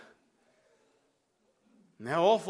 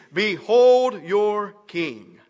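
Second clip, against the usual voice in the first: drawn-out, louder announcement to the crowd; slow proclamation; 8 dB louder, much slower than usual. A narrator's reading. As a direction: present him slowly, like a herald. Pilate presents Jesus to crowd.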